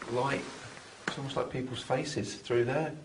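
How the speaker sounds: ordinary talk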